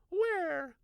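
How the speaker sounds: high breathless gasp of a question. A narrator's reading. Wife arriving out of breath, breathless question. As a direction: ask while out of breath from running. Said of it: "asked breathlessly".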